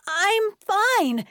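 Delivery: high, loud, firm protest. A narrator's reading. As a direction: raise your voice to be heard. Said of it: "louder this time".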